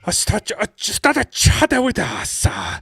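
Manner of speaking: high, slow hammed-up Japanese accent; mock Japanese accent. Showing co-hosts the regained accent, mock Japanese. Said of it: "I got back to it in the end".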